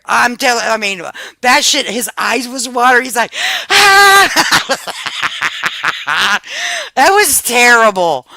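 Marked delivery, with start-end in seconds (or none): 3.7-4.8 high, loud imitated yelp; short, hammed-up "ah" of pain; mock pained scream. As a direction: cry out like pepper hit your eyes. Imitates brother's pepper-in-eyes cry.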